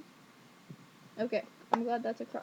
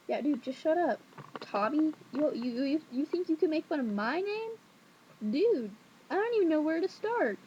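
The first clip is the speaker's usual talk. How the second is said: mock indignant comeback; 5 semitones higher, 3 dB louder than usual. Mock comeback to the teasing classmate.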